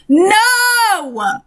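long, high drawn-out "no"; horrified protest. Real, not acted. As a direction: wail the refusal, stretched and high-pitched. Reader horrified by the kiss.

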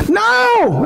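high ringing call; shouted no. Acted. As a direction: fire the answer back at them. Calling out answer to congregation.

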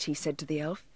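plain reading voice

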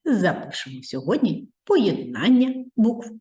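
usual speaking voice

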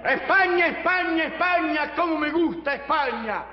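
high, impassioned cries; hammed-up love for Spain; exalted praise. Elogio exaltado de España. (exaltación apasionada y aguda)

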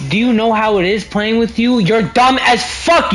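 escalating angry yelling; losing it, shouting them down; heated family shouting. Real, not acted. Angry gaming rant at friends.

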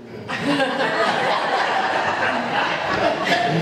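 a breathless burst of real laughter. Real, not acted. Woman laughs at the lyric on her tape, real laugh. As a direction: laugh it out, caught off guard.

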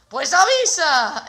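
loud, slow exclamation; mock exasperated call; emphatic. Real, not acted. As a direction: drag it out for emphasis. Exclaiming about alerts.

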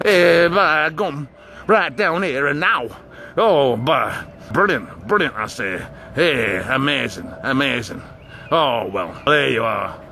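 gruff Yorkshire by-gum voice; Yorkshire accent. Acted. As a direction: lay on a northern Huddersfield accent. Northern Huddersfield accent put on.

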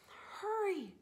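ordinary delivery, nothing marked

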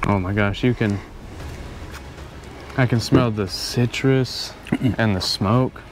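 ordinary talk, nothing marked

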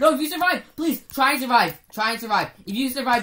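loud desperate pleading; pleading loudly. Real, not acted. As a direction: beg them urgently. Begging teammate to survive.

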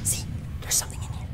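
hushed rushed whisper; fast whisper. Real, not acted. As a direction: whisper so it won't hear you. Whispering fearfully in the dark.

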